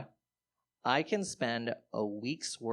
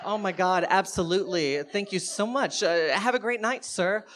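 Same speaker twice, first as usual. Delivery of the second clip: high, loud, effusive thanks; over-grateful gush; 6 semitones higher, 5 dB louder than usual. Retold or imitated. Comic thanking his mugger, loud and effusive. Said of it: "I was like".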